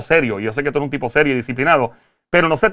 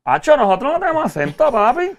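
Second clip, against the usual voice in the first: mock naive belief; 5 semitones higher than usual. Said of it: "pensábamos mucho tiempo atrás".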